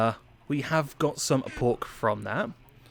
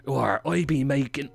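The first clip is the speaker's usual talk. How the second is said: put-on English farmer accent slipping into pirate; 2 semitones higher than usual. Host attempts a farmer voice for viewers, comes out pirate accent. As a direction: put on a mock West Country pirate burr. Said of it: "that's a pirate".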